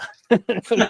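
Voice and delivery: bright burst of laughter; laughing. Real, not acted. Podcaster cracking up at the punchline, laughing. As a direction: laugh at your own story.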